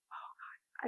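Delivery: breathy horrified whisper; shocked whisper. Real, not acted. Mom reacting to the murdered baby, whispering in shock. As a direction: whisper it, too shaken to voice it.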